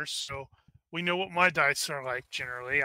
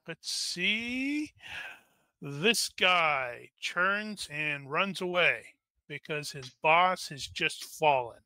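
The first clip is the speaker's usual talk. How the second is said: ordinary talk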